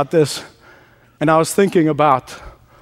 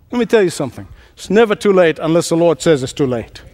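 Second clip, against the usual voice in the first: ordinary talk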